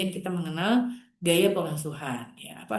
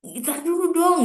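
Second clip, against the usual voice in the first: higher, louder impatient hold on; exasperated brush-off; 8 semitones higher, 4 dB louder than usual. Retold or imitated. Mother cuts off her talkative child mid-chat, mildly exasperated. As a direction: wave the chatty kid off, irritated. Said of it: "suka gemes juga".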